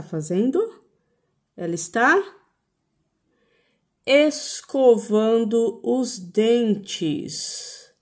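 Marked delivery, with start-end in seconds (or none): 1.6-7.9 drawn-out "escovando os dentes"; very slow teacher model. Shown as a demonstration. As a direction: stretch "escovando os dentes" for children. (fala bem lenta e didática)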